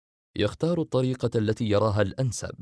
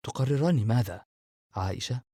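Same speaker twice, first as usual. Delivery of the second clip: usual speaking voice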